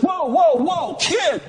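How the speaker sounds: high mock call to stop a boy; big man calling out. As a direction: hold up the kid with a playful whoa.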